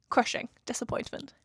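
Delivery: hushed, mock-tragic whisper; dramatic whisper. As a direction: breathe it out like a tragedy. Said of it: "That's a bit dramatic".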